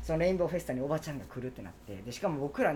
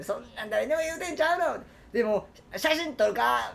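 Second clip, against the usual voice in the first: high brash Osaka auntie, slowing; 7 semitones higher, 3 dB louder than usual. Retold or imitated. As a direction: needle him like a pushy auntie. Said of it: "みたいな感じで言われて".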